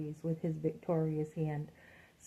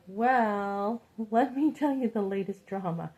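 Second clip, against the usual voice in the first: loud, laughing reply; 4 semitones higher, 6 dB louder than usual. Retold or imitated. Telling the older man her drama, laughing. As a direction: laugh through it as you launch into gossip. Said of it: "And I'm like".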